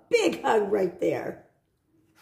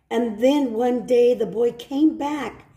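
plain reading voice

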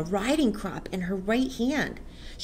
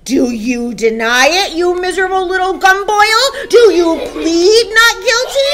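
loud demand climbing to a high shout; furious interrogation; 10 semitones higher, 14 dB louder than usual. Acted. Headmistress demands the boy confess, furious shouting. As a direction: shout it, building higher to the end.

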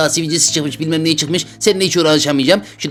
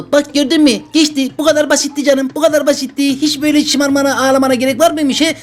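mock chiding; 7 semitones higher than usual. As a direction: chide the crybaby, half teasing. Doctor chides the crying patient.

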